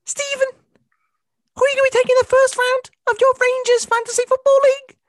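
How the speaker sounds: high, loud Scouse Carragher voice; hammed-up Scouse question, pitched well up; Scouse accent. Carragher impression questioning Gerrard in a loud Scouse voice. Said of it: "pretty good Scouse accent".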